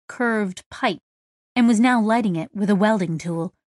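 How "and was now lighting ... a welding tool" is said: usual speaking voice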